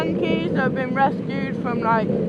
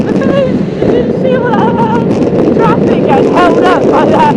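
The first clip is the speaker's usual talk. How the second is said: high, loud rant over the traffic; shouting out an irritated complaint; riled outburst; 9 semitones higher, 10 dB louder than usual. Real, not acted. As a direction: raise your voice, high and exasperated. Rider riled by traffic queue.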